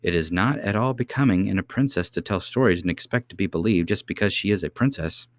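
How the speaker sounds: ordinary delivery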